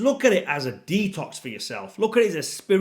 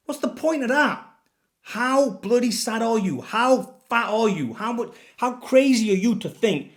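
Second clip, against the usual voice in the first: incredulous rant; 7 semitones higher than usual. Real, not acted. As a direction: hammer each question at them, pitched up. Ranting at trainers' hunger fears.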